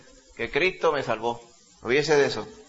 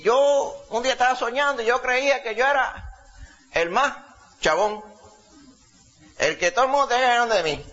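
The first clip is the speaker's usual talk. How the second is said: high imitated old man telling his dream; old man's voice; 7 semitones higher than usual. Preacher voicing the old man telling the youth group about his dream, high old man's voice. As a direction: lift the voice high and play the old storyteller. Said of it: "hablaba con esa voz".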